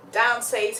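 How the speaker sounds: ordinary talk, nothing marked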